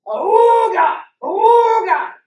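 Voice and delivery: blaring foghorn, shouted high and loud; loud horn imitation. A garbage scow's horn jolting the sleeping crew awake, shouted. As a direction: blast it like a boat horn waking you up. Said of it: "Until I hear".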